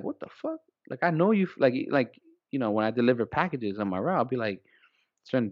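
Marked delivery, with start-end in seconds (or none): 0.0-0.6 a high squeak of "What the fuck?", much higher than his voice; incredulous, high-pitched; mock disbelief. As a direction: play it as stunned disbelief, pitched way up. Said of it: "I look at you like".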